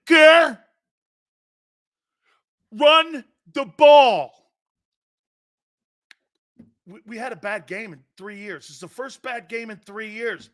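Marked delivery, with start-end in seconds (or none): 0.0-4.5 high, slow, loud mock fan shout; fan yelling. Angry Eagles fan yells at coaches, high, slow and loud. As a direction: howl it at the coaches from the stands.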